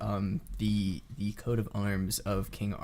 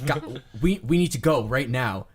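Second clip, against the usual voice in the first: pressing, slightly louder urging; urgent guard; 3 semitones higher, 5 dB louder than usual. Acted. Guard tells the group to flee, urgent. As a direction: hurry them out at once.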